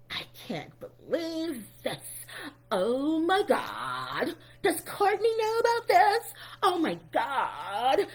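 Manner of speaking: drawn-out, hammed-up shock; mock dramatic disbelief. Retelling a reality star's shocked phone call, mock dramatic disbelief. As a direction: play up the distraught disbelief, very slowly.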